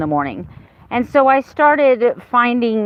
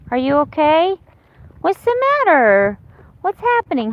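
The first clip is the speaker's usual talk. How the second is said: high, sweet pet voice, much higher; 7 semitones higher than usual. Real, not acted. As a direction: coo at the puppy in a high voice. Talking to her puppy.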